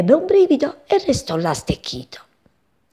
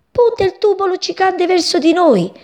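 much higher and louder, alarmed; frightened exclamation; 10 semitones higher, 4 dB louder than usual. A narrator's reading. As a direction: warn the others in fright. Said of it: "esclamò un passero pieno di paura". (esclamazione acuta e spaventata)

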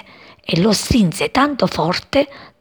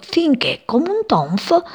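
ordinary delivery

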